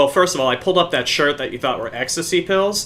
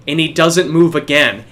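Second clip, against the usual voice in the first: usual speaking voice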